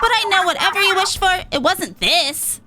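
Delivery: rally the team, cocky; Pearl, fired up. Acted. Pearl promising Marina they'll stop Order, fired up.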